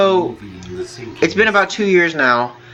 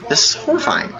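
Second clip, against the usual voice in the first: high-pitched, lightly hammed-up horror; mock horror; 10 semitones higher than usual. Retelling the shock of cops at the door, mock horror. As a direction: repeat it in a high, mock-horrified voice. Retold horrified reaction to police visit.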